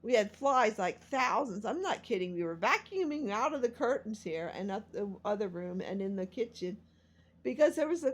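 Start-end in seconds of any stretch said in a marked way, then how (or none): none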